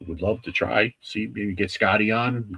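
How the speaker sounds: ordinary talk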